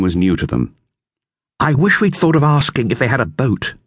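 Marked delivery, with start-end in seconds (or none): 1.6-3.7 higher, lightly voiced boy; boy's voice, lifted higher; Dick. A narrator's reading. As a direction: read it as young Dick. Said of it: "said Dick".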